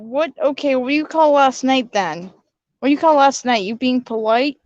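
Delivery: normal talking voice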